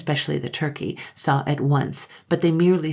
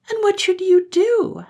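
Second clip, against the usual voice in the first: put-on high, eager voice of small children; higher child voice, cried out; children's voice; 9 dB louder than usual. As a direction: play the pleading little ones. Blue Eyes asks.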